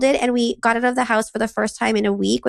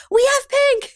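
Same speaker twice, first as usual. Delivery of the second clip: put-on much higher little-kid voice; toddler voice; slower than usual. Son answering his mom about markers, high toddler voice. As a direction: play the eager three-year-old.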